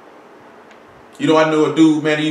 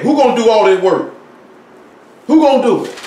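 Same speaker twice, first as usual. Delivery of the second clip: voicing the questioner; 4 semitones higher, 3 dB louder than usual. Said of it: "that man sat back and said".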